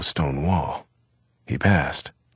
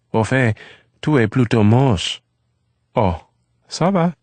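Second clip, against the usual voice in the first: lightly voiced boy, higher, teasing; French phrase in Eve's voice; 8 semitones higher, 5 dB louder than usual. A narrator's reading. Eve teasing Eric in French, lightly voiced boy. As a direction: tease back in French.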